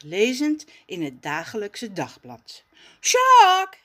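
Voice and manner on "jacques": much higher, loud happy call; enthusiastic shout. Acted. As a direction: shout across to the cousin. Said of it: "gilt Raf enthousiast".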